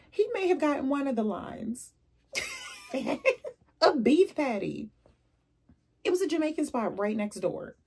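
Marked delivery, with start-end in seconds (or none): 2.3-3.5 short high-pitched burst of laughter. Real, not acted. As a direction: let out a quick high laugh. Laughs at her own story.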